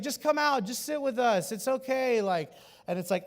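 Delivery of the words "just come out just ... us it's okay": high-pitched pleading coax; acted wheedling, pitched up; coaxing friend. Imitates friends coaxing someone out.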